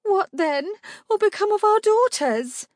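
very high, loud, panicked outcry; rising pitch and volume. A narrator's reading. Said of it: "her voice rising in pitch and volume".

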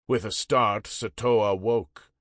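ordinary delivery

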